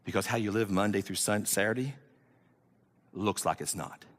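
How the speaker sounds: low, hushed, deliberate warning with long pauses; soft, low pulpit gravity; solemn preaching. Real, not acted. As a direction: bring it down low and quiet, let each phrase land.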